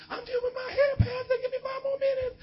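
put-on very high squeaky girlish voice; girl stalling the pastor. Girl calling from inside to the pastor waiting with the bus, very high and squeaky. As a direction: squeal it like a teen girl doing her hair. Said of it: "Janiqua, come on, girl".

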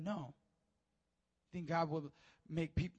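normal talking voice

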